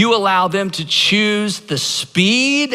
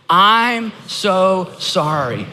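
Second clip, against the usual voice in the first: stretched words with space between; model apology; much slower than usual. Shown as a demonstration. Pastor leading the congregation in practice, very slow and deliberate. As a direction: lead it very slowly, word by word. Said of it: "One more time".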